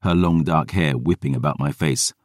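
usual speaking voice

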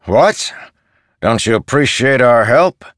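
loud, high indignant soldier; mock offended. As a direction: act offended at the boy.

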